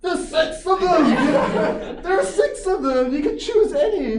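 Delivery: high, loud, laughing slow outcry; exasperated inner shout. Retold or imitated. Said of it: "in my head I'm like".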